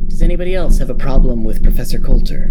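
flat, bored menace, loud. Acted. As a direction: threaten without caring. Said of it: "in an almost bored voice".

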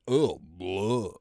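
guttural retching noises mocking French; exaggerated throaty "ugh, blah"; mock French gagging. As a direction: imitate a language as if about to barf. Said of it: "Sounds like they're about to barf".

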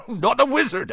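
amused disbelief, laughing as he speaks; chuckling. A narrator's reading. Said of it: "Hagrid chuckled".

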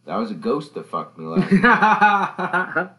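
high, loud, slow mock voice; mock voice of a woman claiming a ghost encounter; mocking the dreamer. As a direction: ham up someone's absurd claim. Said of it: "your first thought is".

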